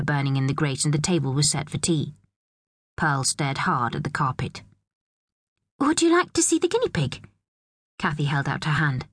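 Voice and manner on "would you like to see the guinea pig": high, sing-song invitation; coaxing a child. A narrator's reading. Kathy offering Pearl the guinea pig, coaxing a child. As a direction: talk down sweetly, pitched up high.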